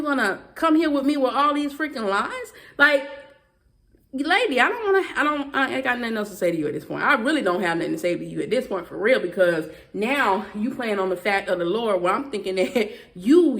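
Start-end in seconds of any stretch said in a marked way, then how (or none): none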